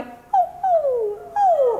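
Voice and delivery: very high whimpering ooh; puppy dog cry. Shown as a demonstration. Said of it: "I might do a puppy dog cry".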